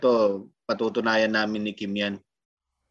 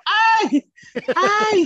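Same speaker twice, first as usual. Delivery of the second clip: high, loud hammed-up yelps of pain; mock pain yells; 8 dB louder than usual. Mimicking boys yelling at their circumcision, high mock cries. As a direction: yelp like a boy being circumcised, laughing through it. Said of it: "papasigaw ka eh".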